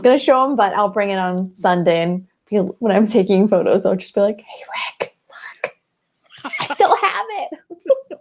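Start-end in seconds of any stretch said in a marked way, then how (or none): 4.4-5.7 hushed, whispered call to a friend; whispered greeting. Pretend. Podcaster pretends to show Rick his old card, whispering. 6.4-8.2 high, slow, laughing little squeal; mock excited reveal. Podcaster pretends to show Rick the kept card, giddy squeal.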